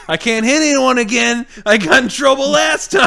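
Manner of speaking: high, loud mock whining character; Cool Cat. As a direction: protest like a hammy repeat offender. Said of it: "It made it sound like he's done it before".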